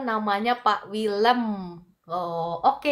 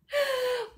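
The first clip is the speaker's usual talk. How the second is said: sudden high-pitched embarrassed giggle. Real, not acted. Embarrassed over misreading a name. (tawa kecil bernada tinggi)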